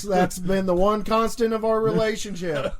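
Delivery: usual speaking voice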